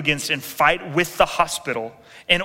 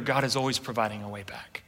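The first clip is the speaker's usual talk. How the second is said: a secret of hope, whispered; whispered assurance; 5 semitones lower, 9 dB quieter than usual. Real, not acted. Preacher hushing the congregation with hope, whispered. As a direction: whisper it, hushed and unvoiced.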